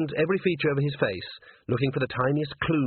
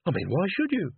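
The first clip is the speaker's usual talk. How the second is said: astonished; 7 semitones higher than usual. Acted. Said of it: "Carrot looked astonished".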